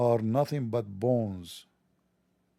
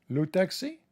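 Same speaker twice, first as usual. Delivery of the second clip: higher-pitched mock-French taxi hail; 7 semitones higher than usual. Shown as a demonstration. Showing how to stop a taxi in France, mock French. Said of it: "you don't speak French".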